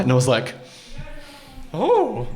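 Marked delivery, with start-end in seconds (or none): 1.7-2.3 mock awe. Passenger named at the gate, mock awestruck.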